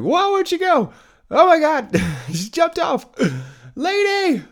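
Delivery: high-pitched, loud comic disbelief; hammed-up shriek of amazement, calling after someone; mock shocked yelling. Mock startled reaction to the jump.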